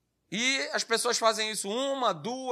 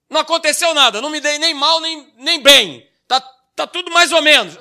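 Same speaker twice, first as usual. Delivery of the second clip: loud, high, exaggerated excuse-making; desculpa encenada; 5 semitones higher, 11 dB louder than usual. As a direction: act out someone insisting at full volume on a flimsy excuse. Acted drinker finding any excuse. (desculpa exagerada, em voz alta e aguda)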